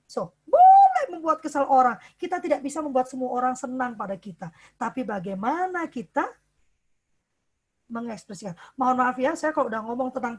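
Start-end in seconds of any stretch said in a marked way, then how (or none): none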